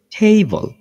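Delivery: টেবল with a puffed, aspirated T; correct English pronunciation. Shown as a demonstration. Teacher answering how the spelt word is said, aspirated pronunciation. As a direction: say টেবল as "thei-bol", breathy puff on the T. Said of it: "এটার সঠিক উচ্চারণ কি হবে?".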